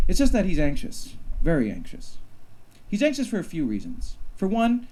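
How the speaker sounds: lively read-aloud storytelling voice; wry, deadpan story reading with a punchy build; story narration. A narrator's reading. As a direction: read your comic sci-fi story to the crowd. Reads his short story aloud.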